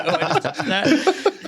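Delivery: very high, slow, laughing through it; disgusted laughing squeal. Acted. Attendant to herself after touching sweaty ticket, squealing in disgust. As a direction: recoil in mock disgust.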